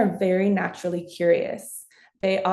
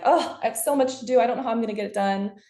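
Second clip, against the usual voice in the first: frantic rush; 3 semitones higher, much faster than usual. Retold or imitated. Stressed parent rattling off chores to herself, fast and panicky. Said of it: "instead of just being like".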